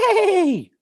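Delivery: high, loud imitated whinny; whinny like a horse; horse neigh.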